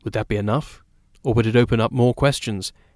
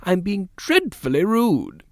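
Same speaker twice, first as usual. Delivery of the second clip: a hint of a chatty, insistent lady of the house; genteel hostess talking over her guests; lightly voiced society lady; 8 semitones higher, 3 dB louder than usual. A narrator's reading. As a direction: play the eager hostess, a little higher and slower. Narrator voices Mrs Fortune.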